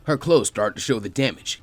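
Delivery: usual speaking voice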